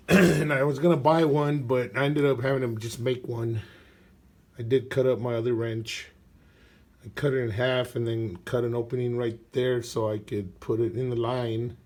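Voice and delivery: usual speaking voice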